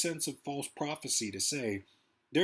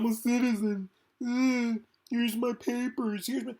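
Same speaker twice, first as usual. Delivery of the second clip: mock frightened plea; 8 semitones higher, 4 dB louder than usual. Stopped man pleading with six armed men, frightened. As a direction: beg them to look at your papers. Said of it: "you're gonna be like".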